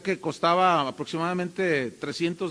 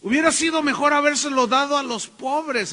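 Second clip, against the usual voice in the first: Judas feigning piety; 7 semitones higher, 6 dB louder than usual. Retold or imitated. Judas complaining about the perfume, mock pious. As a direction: play Judas faking concern. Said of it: "Él dijo".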